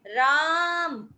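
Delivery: "राम" with the aa held very long, slow; prolonged call. Shown as a demonstration. Teacher shows pluta vowel length, very drawn out. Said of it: "यानी लंबी आवाज होती है".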